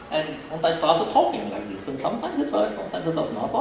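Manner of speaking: put-on German accent, lilting and uneven. Shown as a demonstration. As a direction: talk in a playful mock German accent. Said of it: "Can you do a German accent".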